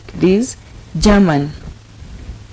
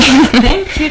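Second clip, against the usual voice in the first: higher, louder thank you, bubbling over; excited thanks; 7 semitones higher, 6 dB louder than usual. Real, not acted. Host thanks her guest on set, excited and loud. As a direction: gush your thanks at the guest.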